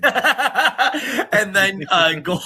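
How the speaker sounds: can't get the name out for laughing; real helpless laughter. Real, not acted. Cracking up mid-sentence.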